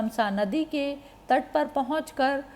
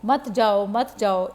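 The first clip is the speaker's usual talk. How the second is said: understated calling out, louder at the end; mild narrated cry; 3 dB louder than usual. A narrator's reading. Townsfolk calling after Ram's departing chariot, mild narrated shout. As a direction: call after someone, building to a restrained shout. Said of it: "इस प्रकार चिल्लाते हुए".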